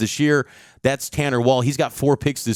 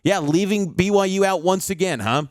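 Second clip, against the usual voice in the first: higher-pitched mock-innocent sarcasm; sarcastically quoting, pitched up in feigned wonder; sarcastic; 6 semitones higher than usual. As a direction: imitate a sarcastic social media jab. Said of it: "kind of sarcastically going".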